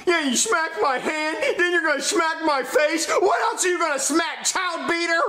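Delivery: high accusing shouts; angry kid shouting. Acted. Child to father, angry high shouting. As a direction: yell the accusation at dad.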